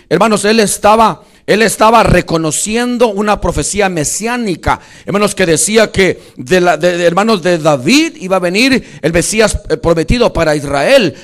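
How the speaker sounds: loud, emphatic sermon delivery; full-voiced pulpit exposition; forceful preaching. Real, not acted. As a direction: drive the teaching home to the congregation. Preacher expounds a prophecy forcefully.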